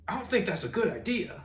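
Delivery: high-pitched retold objection; worried friend. Friend phones to warn him off driving, worried.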